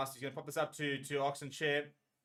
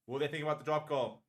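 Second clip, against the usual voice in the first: ordinary talk, nothing marked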